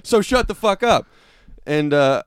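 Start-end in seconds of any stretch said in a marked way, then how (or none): 0.0-1.1 much higher and louder, yelling him down; loud shouting. Retold or imitated. Telling the heckler off, loud high yell. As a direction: shout the man into silence. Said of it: "I just kept getting louder and louder".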